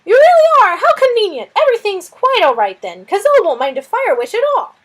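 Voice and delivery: high, loud lizard delight; a flustered lizard thrilled at the news. Acted. Lizard Suz marvelling at the fire witch, high and loud.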